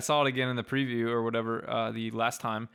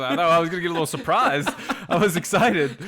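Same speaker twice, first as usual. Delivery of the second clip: laughing; 4 semitones higher, 10 dB louder than usual. Real, not acted. Mock excitement at surprise.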